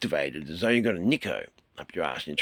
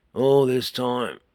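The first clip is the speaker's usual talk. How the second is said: slow and louder, stretching the words; drawn out slow; 6 semitones higher, 8 dB louder, much slower than usual. Shown as a demonstration. Teacher stretching the words to show the solenoid delay, slow and loud. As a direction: drag it out to show the long wait. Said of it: "a good example of what I'm trying to say".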